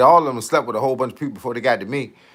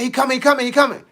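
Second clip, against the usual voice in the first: panicked warning; 10 semitones higher, faster than usual. Retold or imitated. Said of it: "I said".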